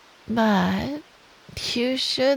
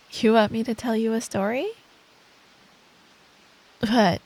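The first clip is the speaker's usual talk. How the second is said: ordinary talk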